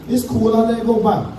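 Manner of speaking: ordinary talk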